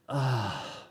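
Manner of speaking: low, soft sigh of heaviness; heavy sigh. Shown as a demonstration. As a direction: let out a sigh under life's weight. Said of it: "become very heavy and disturbing, sometimes we just go".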